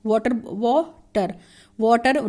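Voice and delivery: Water slow, rising on वा and falling on टर; rise and fall on water. Shown as a demonstration. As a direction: lift वा, drop टर in water. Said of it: "W ए में हमारा जो voice है ... rise ... टर में नीचे". (वाटर में वा ऊपर, टर नीचे)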